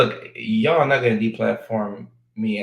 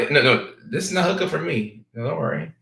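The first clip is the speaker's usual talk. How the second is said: ordinary delivery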